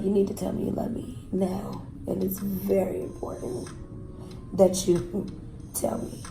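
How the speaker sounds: quiet menacing demand. Retold or imitated. Retelling her abuser holding a gun to her, quietly menacing. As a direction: lean in and press quietly, like a threat.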